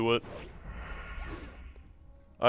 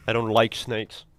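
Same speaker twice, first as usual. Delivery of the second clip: ordinary delivery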